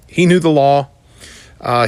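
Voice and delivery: ordinary talk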